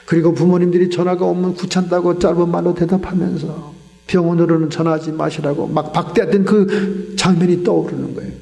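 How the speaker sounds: ordinary talk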